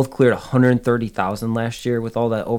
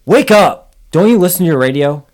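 much louder, higher barking sergeant; irritated bark; 7 semitones higher, 13 dB louder than usual. A narrator's reading. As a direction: snap at him to wake up. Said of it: "A thoroughly irritated patrol sergeant barked".

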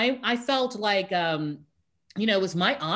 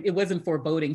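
ordinary talk, nothing marked

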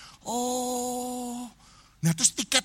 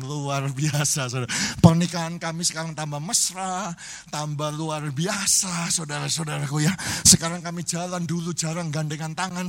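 ordinary delivery

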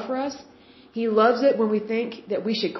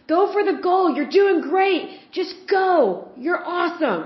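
cheering parent yell; 8 semitones higher than usual. Retold or imitated. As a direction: shout encouragement like a proud parent at a soccer game. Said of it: "in the stands yelling at their kid".

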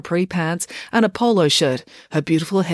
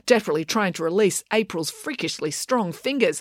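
usual speaking voice

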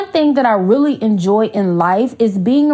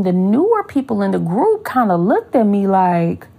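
ordinary delivery

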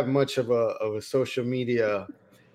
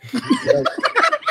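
sudden high burst of laughter; laughing at his own search; 3 dB louder than usual. Real, not acted. Host laughs at own story.

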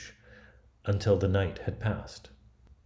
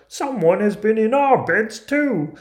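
very high, loud dwarf outcry; alarmed storybook dwarfs, raising the alarm; dwarfs' cry; 12 semitones higher, 9 dB louder than usual. A narrator's reading. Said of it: "the others came running and cried".

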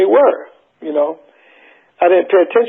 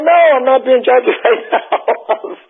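laughing denial; 8 semitones higher, slower than usual. Retold or imitated. To the Four Tops, denying he's imitating [name], laughing. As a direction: laugh through the denial, a bit slow.

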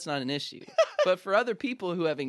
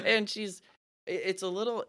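usual speaking voice

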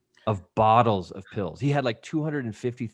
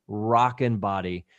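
usual speaking voice

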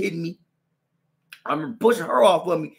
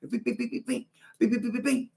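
higher beep punch noises; punch sounds; 9 semitones higher, 4 dB quieter than usual. Retold or imitated. Fighting the Mexican again, punch noises. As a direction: rattle off punches.

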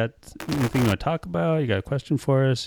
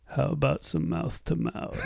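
put-on sleazy drunk line; 6 semitones higher, 9 dB quieter, slower than usual. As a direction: slur it out like a drunk hitting on a nurse. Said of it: "I just went".

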